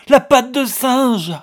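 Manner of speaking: high, very loud and slow frantic cry; frantic scream. A narrator's reading. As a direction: scream the words, drawn out. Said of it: "criait-elle frénétiquement". (cri frénétique, aigu, fort et lent)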